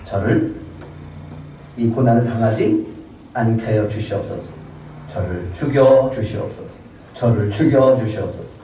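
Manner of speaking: subdued plea to God; low-key acted supplication; praying. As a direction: beg for release, understated. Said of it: "모세가 그렇게 기도해요".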